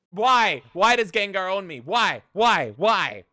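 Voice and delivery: loud, high repeated why; loud mock despair; despairing cries. Real, not acted. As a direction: wail it at the sky. Cries out at Gengar.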